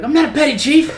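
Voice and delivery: high, loud mock bark of outrage; indignant chief's yell. Chief correcting a recruit who got the title wrong, indignant yell.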